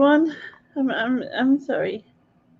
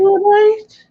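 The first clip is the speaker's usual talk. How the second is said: high, louder mock Brummie; laughing attempt at a Birmingham accent; 9 semitones higher, 5 dB louder than usual. Copying a housemate's Brummie while laughing, in a high Brummie accent. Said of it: "we've got Brummie accent from Susan".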